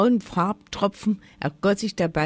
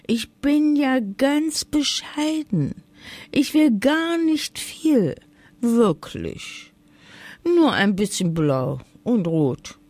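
höher und langsam, süßlich bettelnd; schmeichelnd flötend; 3 semitones higher than usual. A narrator's reading. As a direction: bescheiden tun und doch alles wollen. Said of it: "flötete die Drossel schmeichelnd". (die Drossel bettelt den Elf schmeichelnd um Farben an)

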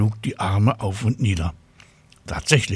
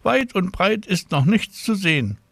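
high-pitched voice of a caller; woman on the telephone; 10 semitones higher than usual. A narrator's reading. Hospital caller passing on Father's greeting, high phone voice. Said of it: "sagte die Frau".

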